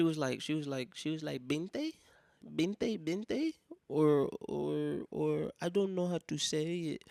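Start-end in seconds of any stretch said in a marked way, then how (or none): 1.5-3.5 lightly voiced clerk with rising questions; higher, questioning lilt for a shop lady; imitated store clerk. 3.9-7.0 hesitant put-on clerk voice; mimicked halting foreign shop lady; imitated store clerk. Imitates clerk struggling with English.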